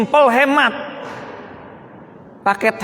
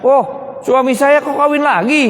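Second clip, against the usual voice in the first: imitating a startled first wife, higher pitch; mock surprise, pitched up in disbelief; kaget; 7 semitones higher than usual. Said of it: "Ya kaget kan istri pertamanya". (pura-pura kaget, nada naik)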